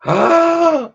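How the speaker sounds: loud high gasp; sharp, high intake of shock. Real, not acted. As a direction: gasp in huge surprise. Shock at a Twitter notification.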